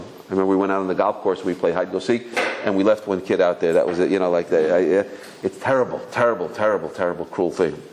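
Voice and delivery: ordinary delivery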